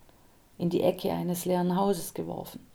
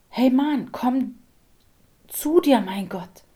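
loud, higher call to wake him; urgent calling; 5 semitones higher, 7 dB louder than usual. A narrator's reading. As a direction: shout him back to his senses. Liv rousing him from unconsciousness.

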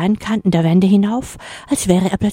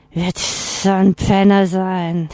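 slow, bored complaint; whiny grumble; 2 semitones higher, slower than usual. A narrator's reading. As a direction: drawl it out, unimpressed. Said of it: "nölte die zischelnde Stimme". (gelangweilt genölt)

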